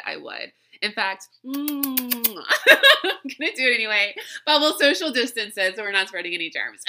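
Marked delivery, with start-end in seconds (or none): 1.5-1.6 long exaggerated "mmm" smooch; big kiss sound. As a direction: hum into a big pretend kiss. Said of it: "give you all a big old kiss".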